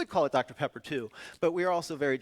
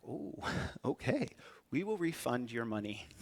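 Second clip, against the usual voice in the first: soft, slightly slowed, chastened retelling; awkward deflated reply; 2 semitones lower, 6 dB quieter than usual. Retold or imitated. Consultants replying to an unhappy client, awkward and soft. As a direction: back down gently with an awkward little laugh.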